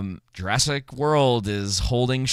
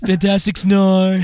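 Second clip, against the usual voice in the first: loud, slow, drawn-out Trump voice; Shane Gillis impression; 8 semitones higher, 9 dB louder, slower than usual. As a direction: boom it out like Trump. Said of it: "I've been watching too much Shane Gillis".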